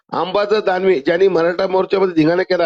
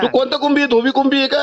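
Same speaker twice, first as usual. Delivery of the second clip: higher, needling demand; taunting challenge; 7 semitones higher than usual. Real, not acted. Caller taunts during quarrel.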